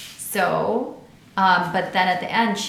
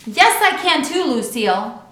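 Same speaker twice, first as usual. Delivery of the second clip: shouting back; 6 semitones higher, 7 dB louder than usual. A narrator's reading. Girls' quarrel.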